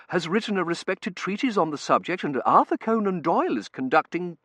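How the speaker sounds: high, loud, lecturing curate; earnest curate. A narrator's reading.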